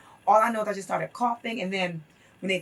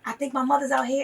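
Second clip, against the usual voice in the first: ordinary talk, nothing marked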